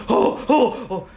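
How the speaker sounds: high, loud mock groans of pain; imitated pained groans. Friend groaning at a cramp while gaming, mock pained oh oh. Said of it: "you hear, he's like".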